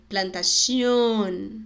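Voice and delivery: "plantación" very slow, with a closed final o. Shown as a demonstration. Teacher giving an example, slow closed vowel. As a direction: draw out the ôn ending of "plantación". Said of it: "sempre bem fechado".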